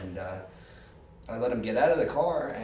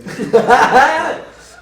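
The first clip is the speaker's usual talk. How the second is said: loud laugh; 14 semitones higher, 18 dB louder than usual. Real, not acted. Teller laughs at own story.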